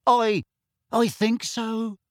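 high, hesitant, shaky reply; unsure, high-pitched wobble. A narrator's reading. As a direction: falter on the first word, then slowly answer. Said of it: "she said shakily".

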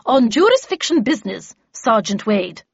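vexed lady putting a guard in place. Acted. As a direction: call it out with irritation. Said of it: "in a vexed tone".

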